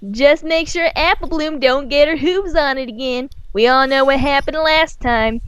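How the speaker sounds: a touch of sisterly teasing; Applejack's voice. A narrator's reading. Applejack teasing her brother about his cider. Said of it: "Applejack laughed".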